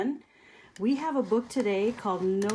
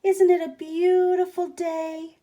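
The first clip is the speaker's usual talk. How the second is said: cheery tumbleweed; 9 semitones higher, 6 dB louder than usual. Acted. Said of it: "Rosie the tumbleweed called out".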